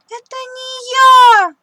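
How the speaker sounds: high, loud, slow tearful denial; crying protest. Acted. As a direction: wail that it wasn't him. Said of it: "плачет Тим". (плачущий мышонок оправдывается)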